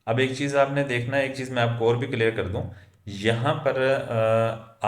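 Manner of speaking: usual speaking voice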